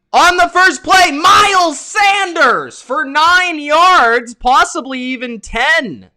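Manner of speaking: high, very loud excited sports call; shouted play-by-play. Real, not acted. Streamer calling a long run on the first play, shouted play-by-play. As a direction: shout the big run, stretching key words. Calls a big run live.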